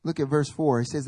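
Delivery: usual speaking voice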